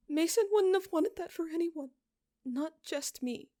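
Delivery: put-on high, tear-choked girl's voice, slowing on the last words; a grieving girl forcing words out; hoarse high voice of a girl who has screamed herself raw; hoarse, tearful girl. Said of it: "her voice hoarse from screaming".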